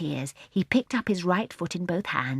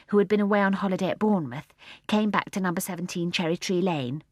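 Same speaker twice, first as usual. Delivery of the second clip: ordinary delivery, nothing marked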